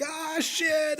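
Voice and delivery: high, softened cursing wail; thin high cry of alarm, held back; mock dismay. Retelling the bathroom flood, high hushed dismay. Retold dismay at water blowing up.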